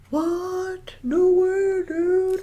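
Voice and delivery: high, gasping fake shock; mock disbelief. Co-host feigning shock at news of police militarizing, mock disbelief.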